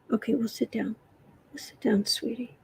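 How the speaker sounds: soft, near-whispered comfort; calm the kitten with a hush; tender hush to cat. Real, not acted. Owner soothes upset cat, soft tender whisper.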